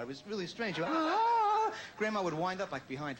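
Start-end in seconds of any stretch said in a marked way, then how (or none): 0.9-1.7 high, loud drawn-out "ah"; divining-rod wail. Retold or imitated. Grandson imitating psychic grandma's walker divining rod, high loud wail. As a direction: wail like a detector going off. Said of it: "a Walker divining rod".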